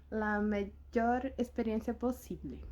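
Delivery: ordinary delivery, nothing marked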